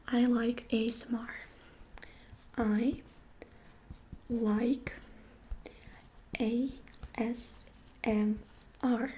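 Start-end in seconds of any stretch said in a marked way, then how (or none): 2.6-9.1 spaced out with long pauses; slow word by word. Shown as a demonstration. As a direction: say it slowly, letting each word land. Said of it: "in English".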